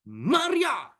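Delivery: high, exclaimed call of a name; stern warning call. Acted. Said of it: "Itu peringatan keras". (seruan peringatan bernada tinggi)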